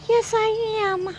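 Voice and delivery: slow, high sing-song yes I am; gasp, then high cutesy yes. Pretend. Owner cooing about being the cutest alarm clock. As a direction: gush over yourself like a baby.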